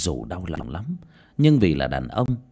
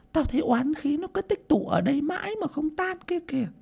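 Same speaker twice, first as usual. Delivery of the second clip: put-on high-pitched elderly seer, ominous; much higher old woman's voice, foreboding; old woman medium, explaining. A narrator's reading.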